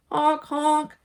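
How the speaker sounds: high honking like a car horn; imitated car horn. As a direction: honk like a cheerful car.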